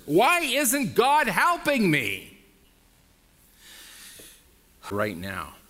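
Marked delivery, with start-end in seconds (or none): 0.1-2.4 high, slow, loud plea; desperate cry. Retold or imitated. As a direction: cry out to God in despair. Voicing a desperate believer.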